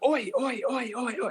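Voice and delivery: high pitched retold yell; shouting oi to stop a sprinting elk; proper yelling. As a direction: call out oi at the elk. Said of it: "proper, like, fucking yelling at him".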